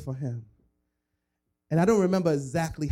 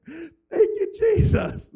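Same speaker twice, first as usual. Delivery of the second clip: high, loud, tearful hallelujah; hammed-up worshipper overcome with joy; ecstatic crying praise; 6 dB louder, slower than usual. Teen mocking his own eager prayer, high tearful cry. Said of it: "I was just on earth".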